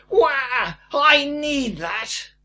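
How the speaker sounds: high, drawn-out bratty wail; screeching kid. Retold or imitated. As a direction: screech it slowly like a child in a supermarket. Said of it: "heard a kid screeching something like".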